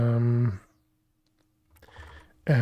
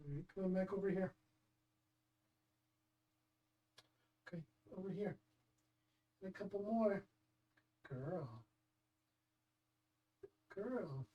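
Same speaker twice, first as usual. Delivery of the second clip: pet talk; 5 semitones higher, 17 dB quieter, faster than usual. Real, not acted. Coaxing the cat with treats.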